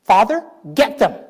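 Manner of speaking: a hint of petty glee, asking God to get them; half-joking plea for payback; lightly hammed-up grudge; mock vengeful prayer. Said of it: "If that was me, you know what I would have said?".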